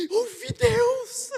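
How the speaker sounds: high, slow joyful cry of discovery; excited cry. Retold or imitated. Sister waking up thrilled, crying out that she heard God. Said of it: "um dia ela acordou de manhã".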